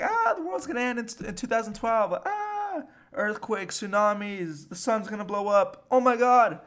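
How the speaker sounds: high-pitched hammed-up doomsday freakout; mock panic. Everyone dreading the world's end in 2012, mock panic.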